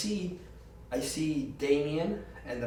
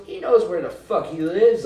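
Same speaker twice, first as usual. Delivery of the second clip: angry retort; 7 semitones higher, 11 dB louder than usual. Retold or imitated. Girlfriend snapping back angrily.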